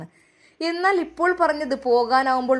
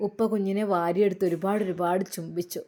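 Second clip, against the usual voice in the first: ordinary delivery, nothing marked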